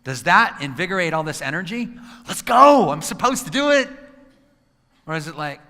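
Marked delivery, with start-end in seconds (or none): 2.3-3.9 loud, high, forced enthusiasm; pushing yourself to do it; 1 reluctant have-to voice. Retold or imitated. Speaker voicing a dreaded obligation, loud and strained.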